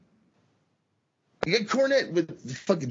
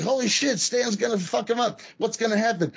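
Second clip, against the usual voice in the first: excited; 9 semitones higher than usual. Retold or imitated. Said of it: "own reaction retold".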